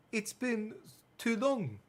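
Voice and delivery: put-on a clumsy French accent, lingering; bad French accent. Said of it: "My French accent is still terrible".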